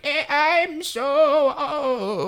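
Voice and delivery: very high, drawn-out fake elderly voice; child playing old. Mimicking Lorraine scooting around, child pretending to be old. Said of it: "when a child pretends to be an old person".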